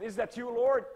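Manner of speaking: loud, high astonished question; preacher amazed at hearing God's voice; startled asking. Retold or imitated. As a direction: ask God in sudden wonder.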